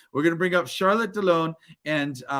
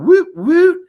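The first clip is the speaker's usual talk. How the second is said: second woot much higher and louder, like a whoop; woot cheer; 14 semitones higher, 11 dB louder than usual. Real, not acted. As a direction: shout the woot like a party cheer. Reading chat cheer aloud.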